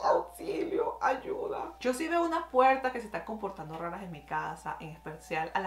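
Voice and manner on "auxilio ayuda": breathless whisper calling for help; hushed, scared call for help; whispered plea. Real, not acted. Vlogger, scared by the doors, whispers a call for help.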